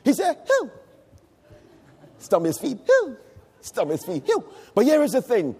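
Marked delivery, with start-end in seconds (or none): 0.5-0.7 small, short "hoo"; a little son's mild copy of dad's whoop; child's imitation whoop. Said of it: "He began to imitate me". 2.9-3.2 short, high little "hoo"; child's imitation whoop. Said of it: "He began to imitate me". 4.3-4.4 short little "hoo"; child's imitation whoop. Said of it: "He began to imitate me".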